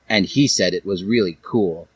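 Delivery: usual speaking voice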